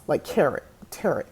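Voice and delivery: TAROT said "TARRIT", rhyming with carrot; rhymed with carrot. Shown as a demonstration. Vlogger showing old mistake, TAROT rhymed with carrot. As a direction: sound the final T in TAROT, like carrot. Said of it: "That's how I was saying it".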